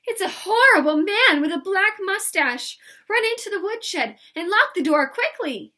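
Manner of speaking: loud, high, urgent shout; excited, high-pitched yelling; excited warning. Acted. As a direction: call out a warning to the countess. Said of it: "said Pippi excitedly".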